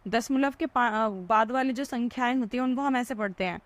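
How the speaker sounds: ordinary talk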